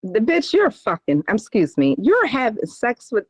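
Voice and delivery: ordinary delivery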